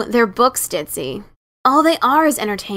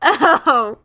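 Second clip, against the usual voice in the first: helpless laughter; 7 semitones higher than usual. Acted. Sister laughs helplessly on the floor. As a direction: laugh until you can't breathe.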